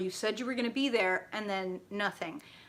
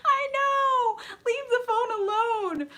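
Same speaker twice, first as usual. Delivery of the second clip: much higher, louder shout, half crying with laughter; real laughing yell; 7 dB louder, slower than usual. Real, not acted. Reader to someone off-screen, yelling much louder with laughter. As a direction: holler at them to put the phone down.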